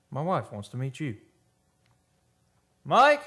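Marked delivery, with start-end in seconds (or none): 2.8-3.2 high, loud call into the next room; a sharp call, rising at the end. Acted. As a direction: shout a name to someone out of sight. Calling his wife into the room.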